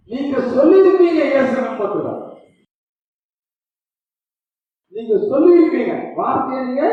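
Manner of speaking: high, loud, driving sermon voice; fiery preaching. Acted. Preacher pressing the congregation on prayer, at full force. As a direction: hammer the point home from the pulpit.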